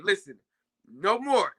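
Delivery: ordinary talk, nothing marked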